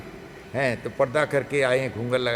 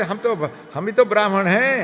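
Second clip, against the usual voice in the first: high, loud offer, half mocking; eager maid; 6 semitones higher, 4 dB louder than usual. Maid offers the Brahmin brothers her own cooking, high and eager. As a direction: push the offer with bright insistence.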